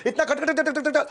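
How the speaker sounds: high rattle of कट, कट, कट; rapid-fire imitation. Viewer asks coach how he talks nonstop, high rapid-fire. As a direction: rattle off कट like a machine gun. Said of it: "आप पूछ सकते हो".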